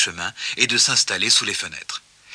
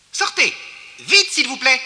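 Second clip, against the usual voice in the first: very high, urgent order; strident hurrying; 12 semitones higher than usual. A narrator's reading. Mole hustling passengers from the lift, strident. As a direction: rush them out on a high pitch.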